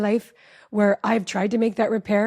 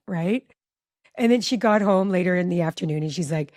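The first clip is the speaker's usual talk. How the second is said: ordinary talk, nothing marked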